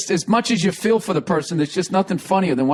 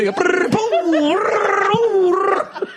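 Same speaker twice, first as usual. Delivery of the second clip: tumbling noises; 12 semitones higher, much slower than usual. Retold or imitated. Imitating the tumbling skater.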